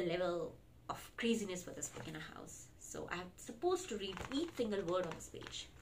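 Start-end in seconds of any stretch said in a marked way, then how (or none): none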